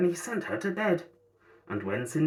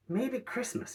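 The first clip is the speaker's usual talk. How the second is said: wondering Grinch; 9 semitones higher, 6 dB quieter than usual. A narrator's reading. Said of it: "he thought".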